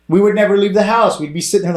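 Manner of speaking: high, loud and worked up; heated rant. Real, not acted. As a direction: push it up, fired up. Heated rant gets louder.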